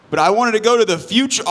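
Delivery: whining pirate, high and quick; high, hurried mock-pirate complaint. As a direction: protest as a jealous pirate, ending on a big "arr". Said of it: "another pirate who saw that first pirate".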